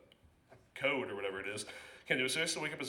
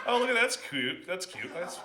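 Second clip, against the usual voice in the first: mock cooing; 6 semitones higher, 4 dB louder than usual. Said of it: "I would never look at one like".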